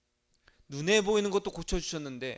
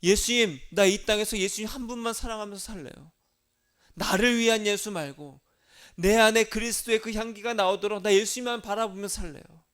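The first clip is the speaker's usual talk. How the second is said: loud, high, impassioned vow; fervent prayer; 3 semitones higher, 6 dB louder than usual. Shown as a demonstration. Believer vowing to love only Jesus, loud and fervent. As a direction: cry it out as a whole-hearted vow. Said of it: "진짜 믿으려면은".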